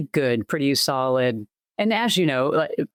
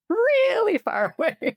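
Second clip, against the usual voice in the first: laughing; slower than usual. Real, not acted. Laughs while saying far away.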